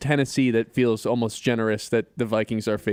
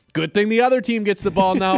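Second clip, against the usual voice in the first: imitating announcers; 7 semitones higher, 4 dB louder than usual. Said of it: "Tariq and Collinsworth are like".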